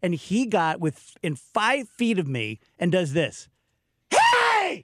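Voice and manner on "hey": sudden high-pitched yell; imitated scream. Cart kid screaming at the other cart kid, imitated yell. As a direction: holler like a teenage cart kid. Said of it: "He was screaming at the other cart kid".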